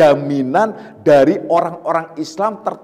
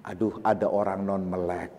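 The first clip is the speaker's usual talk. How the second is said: softer, gentle polite remark; polite version; 9 semitones lower, 11 dB quieter than usual. Shown as a demonstration. Teacher models the polite way to mention a blind person, softer. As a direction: say it kindly and softly. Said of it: "sopannya itu begitu".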